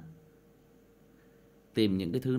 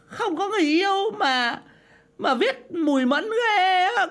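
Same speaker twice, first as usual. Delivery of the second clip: ghostly echo voice; 5 dB louder than usual. Acted. Voice effect while reading comments.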